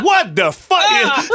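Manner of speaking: high, slow startled exclamation; startled man, high and slow. Retold or imitated. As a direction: drag it out in shock. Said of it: "Et j'entends".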